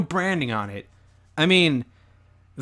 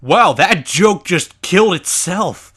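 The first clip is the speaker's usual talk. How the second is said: loud, slow, higher-pitched ribbing; loud heckling; 4 semitones higher, 7 dB louder, slower than usual. Real, not acted. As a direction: call out the failed joke, punching each word. Heckles co-player's failed joke.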